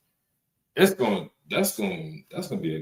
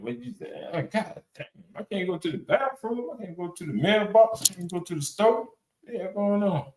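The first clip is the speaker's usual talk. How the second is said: higher, whiny mock complaining in a woman's voice; mock whiny complaint; 9 semitones higher than usual. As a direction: imitate a partner whining about being stuck at home. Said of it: "You whining and stuff like that".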